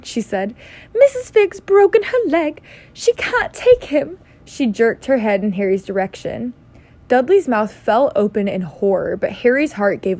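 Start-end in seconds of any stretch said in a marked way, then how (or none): 0.9-4.2 high, loud, put-on aunt voice; angry, worried aunt. A narrator's reading. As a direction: break bad news high and agitated, slowing at the end. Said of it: "looking both angry and worried".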